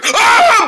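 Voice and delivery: sudden high yell; jump scare shout. Pretend. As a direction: pop out with a loud yell.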